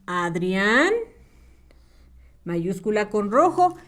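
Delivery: ordinary talk, nothing marked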